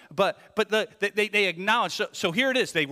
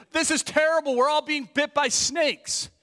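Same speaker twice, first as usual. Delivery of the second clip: higher, louder whining cry about the snakes; panicked complaint; 11 semitones higher, 6 dB louder than usual. Retold or imitated. Preacher voicing the people, panicked complaint. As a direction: cry out like a frightened crowd.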